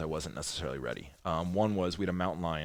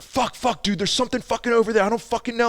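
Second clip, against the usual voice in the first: much higher, louder, fast panic; panicked yelling; 14 semitones higher, 7 dB louder than usual. Retold or imitated. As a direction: panic after shooting into the dark. Drew panicking on phone, quoted.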